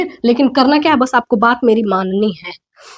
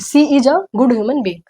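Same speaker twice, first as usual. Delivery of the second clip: slowly spelled-out example; slow model sentence; much slower than usual. Shown as a demonstration. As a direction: say each word slowly for students. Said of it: "यहाँ पर देखिए". (धीमे-धीमे समझाते हुए)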